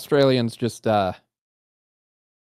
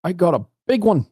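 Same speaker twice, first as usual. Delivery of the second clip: excited; 8 semitones higher, 5 dB louder than usual. Real, not acted. Excited fishing catch.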